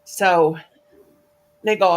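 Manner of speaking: usual speaking voice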